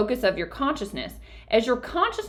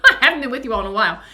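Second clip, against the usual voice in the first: laughing; 6 dB louder, much faster than usual. Real, not acted. Laughing while greeting the audience.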